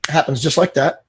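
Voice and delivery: ordinary talk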